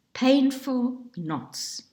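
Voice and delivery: ordinary delivery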